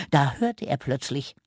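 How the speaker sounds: ordinary delivery, nothing marked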